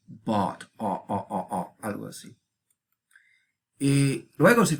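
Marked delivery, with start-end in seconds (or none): 0.1-1.8 bought and O with an open, rounded o; O stretched open like English bought. Shown as a demonstration. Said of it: "algo así como en inglés".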